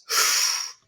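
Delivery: sharp breathy intake of shock; retold gasp. Family hearing of Celtic monsters class, startled gasp. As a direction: gasp as if alarmed by the news.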